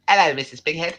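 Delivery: high cartoon greeting; Rocko. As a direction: greet the neighbour in a high character voice. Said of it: "you remember Rocco's Modern Life?".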